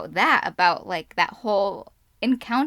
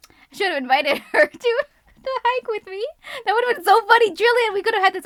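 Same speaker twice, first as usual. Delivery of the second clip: laughing; 12 semitones higher, 6 dB louder, faster than usual. Real, not acted. Laughing at the idea of Jillian there.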